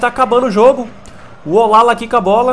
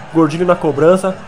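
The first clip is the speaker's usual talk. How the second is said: usual speaking voice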